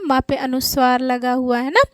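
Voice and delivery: normal talking voice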